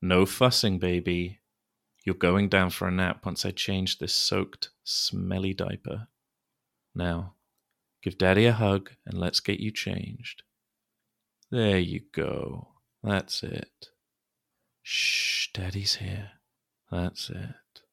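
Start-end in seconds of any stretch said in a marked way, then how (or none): 2.1-13.9 much softer, hushed lulling; soothing a child. Acted. Calming child to sleep. 14.8-15.5 hushing. Acted.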